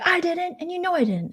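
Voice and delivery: high-pitched indignant denial; put-on high protest; mock yelling. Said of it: "they would just yell at each other".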